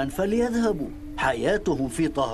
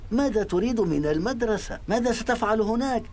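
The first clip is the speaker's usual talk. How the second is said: dismissive; 7 semitones higher than usual. Acted. Father rejects school idea.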